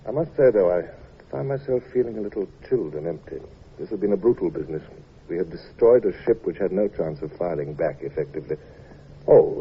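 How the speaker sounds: low, reflective narration; story narration. A narrator's reading. Captain narrating his regret to the listener, subdued. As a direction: tell it inwardly, a little chilled.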